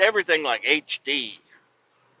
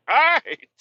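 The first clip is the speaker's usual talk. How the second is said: playful placating; 13 semitones higher, 6 dB louder than usual. Retold or imitated. Answering mechanic after teasing.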